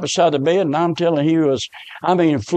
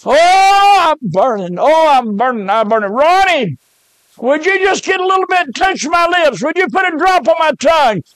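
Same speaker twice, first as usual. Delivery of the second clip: screaming in pain; 8 semitones higher than usual. Retold or imitated. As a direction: cry out in agony, begging. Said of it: "he was screaming".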